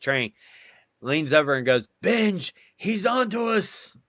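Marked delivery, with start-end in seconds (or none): 2.0-4.0 high, hammed-up warning to a buddy; mock loud stage whisper. Platoon guide leaning over to warn his buddy, a loud mock whisper. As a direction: blurt it far too loud, like a secret. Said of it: "as loud as he could".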